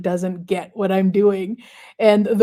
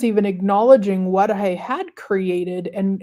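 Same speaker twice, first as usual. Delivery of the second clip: usual speaking voice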